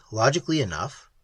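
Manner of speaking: ordinary delivery, nothing marked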